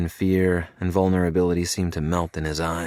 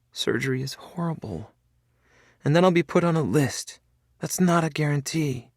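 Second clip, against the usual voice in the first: higher, rising, frightened pleading; a touch of fear; 10 semitones higher than usual. A narrator's reading. Felicity telling Cade her fear of surgery, higher. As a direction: let the dread push the voice up.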